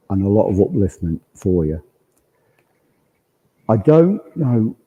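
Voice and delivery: ordinary talk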